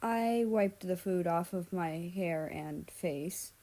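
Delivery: ordinary delivery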